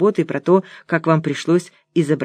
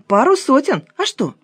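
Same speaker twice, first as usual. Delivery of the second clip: much higher, questioning voice; confirming; 9 semitones higher than usual. A narrator's reading. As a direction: toss back a puzzled question. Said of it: "подтвердила Лена". (подтверждая, с недоумением)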